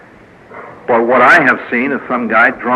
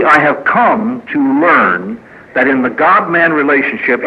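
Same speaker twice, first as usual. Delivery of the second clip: ordinary talk, nothing marked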